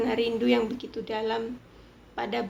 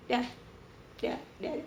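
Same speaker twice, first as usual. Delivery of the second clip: dismissive whisper; 8 dB quieter than usual. Real, not acted.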